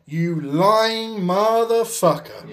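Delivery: slow, drawn-out disbelieving insult; incredulous retort. Retold or imitated. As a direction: drag it out in disbelief. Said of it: "I was like".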